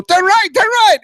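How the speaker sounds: put-on very high, loud accented yell; urgent shouted command. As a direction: yell directions as the foreign-accented coach. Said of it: "And he'd go".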